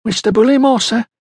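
high-pitched, lightly breathless address; a touch out of breath, pitched high. A narrator's reading. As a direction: report to the boss after hurrying over. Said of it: "she began breathlessly".

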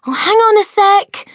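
breezy schoolgirl calling back; Enid calling out. A narrator's reading. Enid calls back through her door, high and breezy. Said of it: "called Enid's voice".

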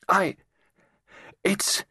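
high, halting stammer; faltering. A narrator's reading. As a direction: start to speak, then break off in shock. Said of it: "the girl broke off".

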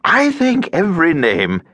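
lively, swooping voice of a chatty man; tiresome cheerful stranger. A narrator's reading. Chatty stranger tries out his new acquaintance's name, tiresomely cheerful. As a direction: play the cheery lift bore, rising and falling. Stranger begins a pet theory.

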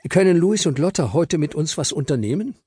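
put-on a boy asking a grown-up nicely; polite boy asking. Joshua asks Papa to let the twins come, polite and high.

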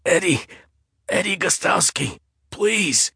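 high, frightened begging; terrified plea. A narrator's reading. Eddie begs the intruder with a knife at his neck, high and terrified.

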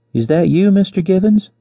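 high, sugary woman's voice; deliberate saccharine woman. A narrator's reading. Josefa teasing her would-be rescuer, deliberate saccharine voice. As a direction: ask it high with put-on sweetness. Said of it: "in her deliberate saccharine contralto".

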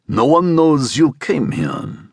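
"No one knows you came here" as a slow statement; question delivered as statement. A narrator's reading. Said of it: "it came off as statement".

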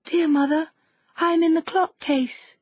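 high, small voice of a little goat; youngest kid's voice. A narrator's reading. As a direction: call out like a tiny frightened kid hiding. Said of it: "a soft voice cried".

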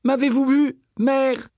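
put-on high, eager child voice; proud child. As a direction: show off to mother. Daughter boasts to mother.

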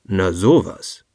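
a touch of wonder, not fear; mildly puzzled. A narrator's reading. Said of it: "hörte sich eher verwundert als erschrocken an".

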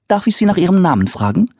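high quick friendly question; polite mother. Acted. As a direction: ask sweetly like a hostess.

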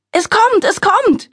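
high, loud frantic scream; screaming mother. A narrator's reading. Mother in labour screaming to the room, high and loud. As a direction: cry out that it's coming. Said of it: "schrie meine Mutter".

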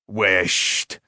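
low-pitched hushing exclamation; crying out. A narrator's reading. Smith hushing the boy's wish, exclaiming low. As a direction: cut him off low, in the smith's voice. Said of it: "cried Karl".

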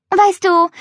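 high, loud boastful opener; showing off; proud announcing. Acted. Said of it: "verkündete sie".

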